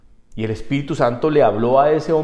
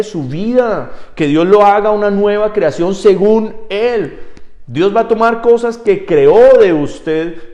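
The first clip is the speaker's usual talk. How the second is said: preaching at full force; 8 semitones higher, 8 dB louder than usual. Acted. Pastor exhorting the church to let God change them, fervent. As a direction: rouse the congregation, hammering each phrase.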